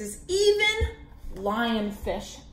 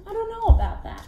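doubtful; 9 semitones higher, 3 dB louder, much faster than usual. Acted. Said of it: "Do you think the lionfish looks very happy".

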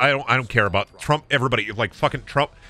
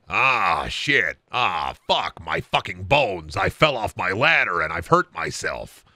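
put-on low, pained old man; 6 semitones lower than usual. Old man fell off his ladder, low groaning. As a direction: groan through it like an injured elder. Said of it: "That's an old man".

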